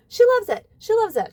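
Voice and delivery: high, loud, delighted friend's voice; gushing friend. Retold or imitated. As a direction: squeal it like a proud puppy owner. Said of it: "She's like".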